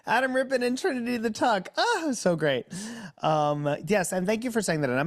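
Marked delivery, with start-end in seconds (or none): 0.1-2.6 high, bright gush of delight; giddy high-pitched rave about the show; excited gushing. Real, not acted. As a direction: squeal it out, thrilled to remember.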